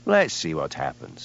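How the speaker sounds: lazy. Acted. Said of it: "said Hamish lazily".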